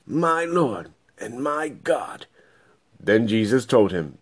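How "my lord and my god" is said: slow, softer, awestruck; a hint of Thomas in quiet awe; awed Thomas. Thomas sees the risen Jesus.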